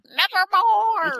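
high, slow, drawn-out spooky croak; raven's nevermore. Retold or imitated. Imitating the raven in the letter scene, spooky and drawn out. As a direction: stretch it out like Poe's raven. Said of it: "waiting for the raven to go".

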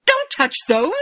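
high, loud mock protest; wife guarding her secret chocolate; protective wife. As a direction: snap at him to leave her stash alone. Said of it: "And she's like".

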